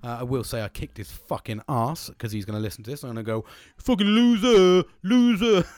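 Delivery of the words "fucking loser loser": loud, high drawn-out gloating jeer; playful loser taunt, stretched right out; mock taunting. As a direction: jeer it slowly at a beaten mate, high and loud. Taunting his friend who will listen.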